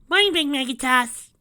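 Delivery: loud, high call across the house; yelled from afar. A narrator's reading. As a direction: call out cheerfully from the other room. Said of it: "Derpy yelled from the bedroom".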